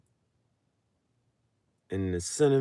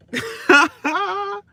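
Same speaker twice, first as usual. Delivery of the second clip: much higher shrieking laugh; high squealing laugh; 5 dB louder than usual. Real, not acted. To viewers at own joke, squealing laugh.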